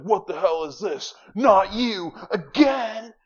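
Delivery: high, loud hammed-up fighter yelling; game fighter's outburst. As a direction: play the outraged fighter over the top. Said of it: "he's like".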